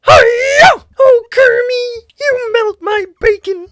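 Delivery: loud, gushing diva pig; hammed-up Miss Piggy. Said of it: "Miss Piggy".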